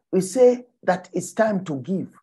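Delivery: normal talking voice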